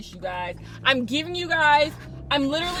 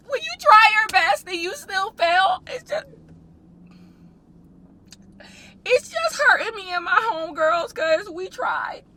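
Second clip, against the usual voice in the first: sobbing; 5 semitones higher than usual. Real, not acted. Vlogger lamenting to viewers that she tried, sobbing. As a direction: cry it out, voice breaking. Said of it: "wondering why I'm crying".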